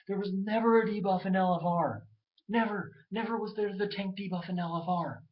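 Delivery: loud, insistent NEVER; heated rant. Real, not acted. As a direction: drive it home with indignation. Ranting about the debuff.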